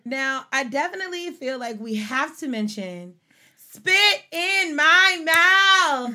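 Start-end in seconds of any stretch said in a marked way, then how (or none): 3.6-6.1 loud, slow, high punchline; emphatic catchphrase. Retold or imitated. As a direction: drag out the catchphrase.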